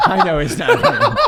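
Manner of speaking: laughing. Real, not acted. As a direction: blurt it through laughter. Laughing outburst.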